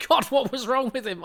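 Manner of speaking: widow's puzzled question. Retold or imitated. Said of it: "she said".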